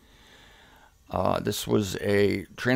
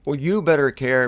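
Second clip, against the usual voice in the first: ordinary talk, nothing marked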